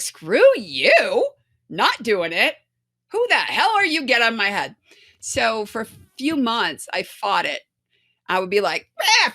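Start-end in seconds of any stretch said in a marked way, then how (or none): 0.0-4.8 loud, defiant shouting back; defiant retort. Retold or imitated. Fighting back against the voice in her head, loud and defiant. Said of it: "And I was like". 9.0-9.3 loud, high mock yell. Yelling at the dark voice in her head, a loud high cry. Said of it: "yelling at it".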